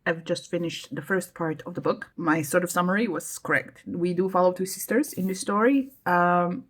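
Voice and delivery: ordinary talk, nothing marked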